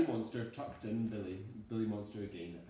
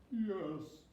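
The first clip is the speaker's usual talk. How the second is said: sobbing; 9 semitones higher than usual. Acted. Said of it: "he sobbed".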